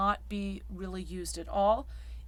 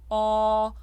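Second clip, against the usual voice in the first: ordinary talk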